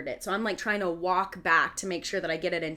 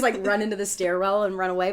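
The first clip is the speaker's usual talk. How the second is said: ordinary talk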